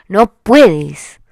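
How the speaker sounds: sudden loud "no puedes"; loud insistence. Real, not acted. Repeats advice loudly.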